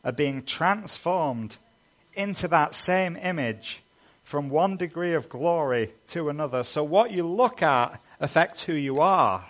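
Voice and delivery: high, loud preaching at full force; impassioned pulpit voice, swelling on key words; fervent preaching. Acted. Passionate preaching about Christ's glory.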